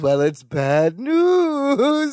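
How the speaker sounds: slow, high, drawn-out mock lament; sing-song wail. Imitating Balky breaking bad news to Larry, sing-song wail. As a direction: stretch the ending into a whiny singsong. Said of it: "hemming and hawing and sing-songing".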